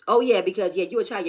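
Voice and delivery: snapping back in a loud mock argument; mock yell. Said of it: "he said".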